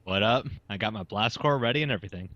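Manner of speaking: ordinary delivery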